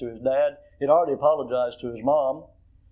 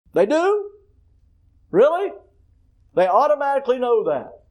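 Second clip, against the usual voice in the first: mocking, much higher 'They do? Really?'; sarcastic disbelief; 8 semitones higher, 5 dB louder than usual. Real, not acted. Preacher mocking parents who never say it, incredulous. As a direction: scoff at the idea, pitched way up.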